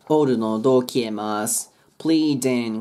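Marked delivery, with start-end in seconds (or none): 2.0-2.8 "Pleading" as "pleeden", no G; I turned E. Shown as a demonstration. Teacher showing the vowel change, silent G. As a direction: end "Pleading" on "en". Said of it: "ここもIはえ、".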